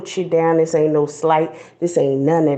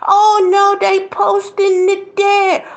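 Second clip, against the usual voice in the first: high, loud, slow mock gasp of outrage; 13 semitones higher, 5 dB louder, slower than usual. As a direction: shriek like a scandalized churchgoer. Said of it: "wanna act like".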